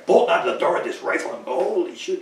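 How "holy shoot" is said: high put-on startled voice; retold excited outburst, pitched up high; mock exclamation. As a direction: play the jumpy son with a rifle. Son bursts out with rifle.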